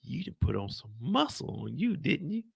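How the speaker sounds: lightly voiced flirty admirer, swooping pitch; imitating Pops. Pops admiring Ronnie's muscle, lightly imitated flirting. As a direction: coo it as a mock come-on. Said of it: "we got fucking Pops talking about".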